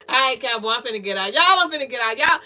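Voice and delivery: usual speaking voice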